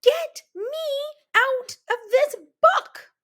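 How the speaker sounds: high, loud, panicked scream; frantic high scream to escape. A narrator's reading. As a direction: shriek at the crawling cockroaches. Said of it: "and I screamed".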